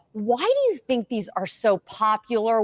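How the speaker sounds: usual speaking voice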